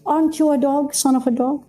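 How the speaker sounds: high, softened, matter-of-fact query; not belittling. Shown as a demonstration. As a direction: ask it harmlessly, with no put-down. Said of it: "the tone is belittling. Versus".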